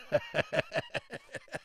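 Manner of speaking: breathy high-pitched giggle; high squeaky laugh. Real, not acted. Laughs after the bit.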